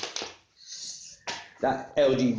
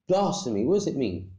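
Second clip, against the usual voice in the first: normal talking voice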